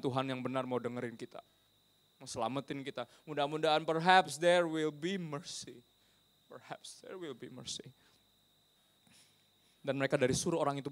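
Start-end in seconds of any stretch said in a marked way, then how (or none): 3.2-5.8 slow, full-voiced English phrase; slow hopeful emphasis. Real, not acted. Preacher voicing sailors' hope for mercy, slow and emphatic. Phrase said strong, then hushed. 6.5-7.9 slow, very soft repetition; hushed echo. Real, not acted. Preacher repeats hope for mercy, slow and hushed. Same phrase repeated much softer.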